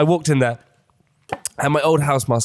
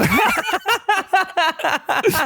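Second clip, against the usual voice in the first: high burst of laughter; a high-pitched laugh at the question; big laugh. Real, not acted. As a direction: crack up laughing. Hair transplant question.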